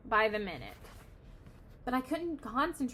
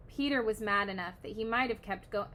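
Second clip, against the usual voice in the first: ordinary delivery, nothing marked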